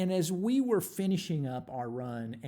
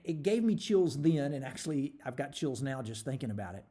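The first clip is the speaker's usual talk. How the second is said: usual speaking voice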